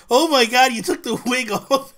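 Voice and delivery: high, loud and laughing excited squeal; a playful mock exclamation; mock shocked fans. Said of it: "they're like".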